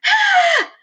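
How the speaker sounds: very high, loud crying shriek; Leslie's shriek in the classroom; girl's scream. A narrator's reading. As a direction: scream out in sudden pain. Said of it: "Leslie screamed".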